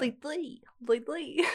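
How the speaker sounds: sing-song like the cartoon boy genius; Dexter's Laboratory voice. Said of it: "almost like an accent".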